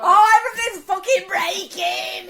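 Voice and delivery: high, loud, slow wail; hammed-up shouted lament; retold meltdown. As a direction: cry out in comic despair. Meltdown while mopping up flood.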